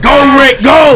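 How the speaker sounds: high, loud, laughing hype; egging Rick on with a laugh; excited cheering. Real, not acted. Cohost cheers Rick on while he tells the story, excited and laughing.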